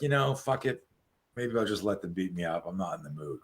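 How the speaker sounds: trailing down low and soft; resigned giving up. Acted. Tired man facing three muggers, softly resigned. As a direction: let it sink into a tired, quiet shrug. Imagined weary victim giving up.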